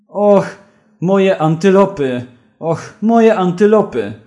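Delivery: high, loud lament; distraught wail. Acted. Zoologist moaning for his antelopes, loud and high. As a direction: wail it like a broken man.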